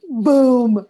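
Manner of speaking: a loud punchy exclamation; boom. Retold or imitated. Speaker hearing her friend's acronym, loud punchy boom. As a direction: land it like a mic drop.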